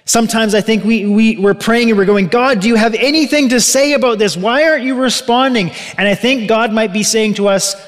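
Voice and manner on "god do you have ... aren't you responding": higher-pitched, mildly exasperated plea; frustrated prayer. Acted. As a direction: act out someone pleading for an answer. Acted frustrated prayer to God.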